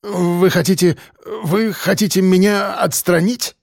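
shocked, halting professor; choked disbelief. A narrator's reading. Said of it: "слова застряли в горле".

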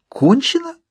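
very high, sharply rising question; outraged disbelief. A narrator's reading. Narrator outraged at the medium giving up, incredulous. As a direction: echo the word in indignation. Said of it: "Мне захотелось тут же задушить его".

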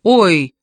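short, loud "oy"; pained yelp. A narrator's reading. Hugo struck in the face, yelping. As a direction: cry out from a slap. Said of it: "вскрикнул Хьюго".

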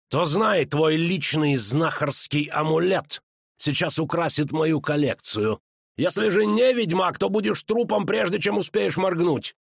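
high, drawn-out menace building in pitch; villain's threat. A narrator's reading. (угрожающе, на повышенном тоне)

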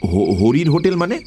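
baffled and caught off guard; taken aback. Acted. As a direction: stumble on the first word, rising in surprise. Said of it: "অবাক হয়ে বললুম".

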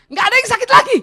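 very high, loud exclamation; elated patient. Acted. Patient freed of pain by the injection, elated. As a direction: announce it in hammed-up relief.

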